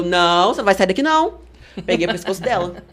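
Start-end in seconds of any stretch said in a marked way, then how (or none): 0.0-1.5 retold defiant refusal. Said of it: "Aí eu".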